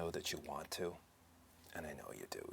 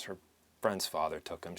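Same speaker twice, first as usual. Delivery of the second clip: normal talking voice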